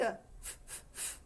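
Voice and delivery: soft hissed f sounds, no voice; unvoiced f drill. Shown as a demonstration. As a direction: push air through the teeth for each f. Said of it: "I'll go first. You be my echo".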